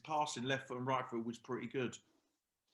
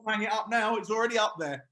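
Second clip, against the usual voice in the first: teasing retort; 8 semitones higher, 8 dB louder than usual. Retold or imitated. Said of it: "Ollie used to hang".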